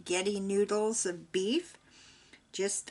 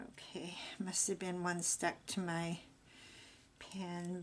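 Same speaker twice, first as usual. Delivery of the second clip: whisper; 8 dB quieter than usual. Real, not acted. Quiet aside while cooking.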